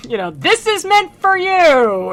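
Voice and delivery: mock taunt. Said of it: "He's like".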